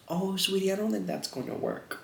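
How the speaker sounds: high, sugary parent voice; sweet mom voice. Retold or imitated. Parent gently letting the child down, high sweet voice. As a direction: imitate a gentle, pitying parent.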